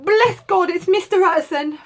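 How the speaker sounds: high, loud overjoyed cook; the cook cries out in relief; crying out. Acted. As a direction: cook shouting with relief at the door. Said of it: "cook crying out".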